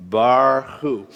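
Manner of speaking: "Barhu" drawn out as two separate syllables; syllable-by-syllable reading. Shown as a demonstration. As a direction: lead the class slowly through "Barhu", bar then hu. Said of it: "Try it again".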